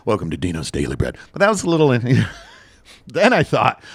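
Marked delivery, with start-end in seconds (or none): 0.1-1.2 low, quick mock monk welcome; monk greeting. A pretend monk welcoming customers to his bakery. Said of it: "be a monk".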